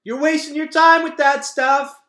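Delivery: loud, slow call across the arena. Acted. Gregor calling across the stadium, a loud call. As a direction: shout it after her from afar. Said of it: "called Gregor".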